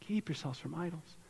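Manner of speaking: very soft, hushed plea; tender warning. Acted. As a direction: caution them gently, almost under the breath. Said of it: "he ends with this tender warning".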